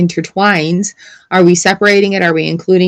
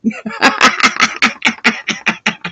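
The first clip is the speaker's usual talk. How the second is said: evil laugh; 3 semitones higher, 5 dB quieter than usual. Real, not acted. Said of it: "As I laugh and evilly laugh".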